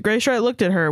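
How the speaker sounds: ordinary delivery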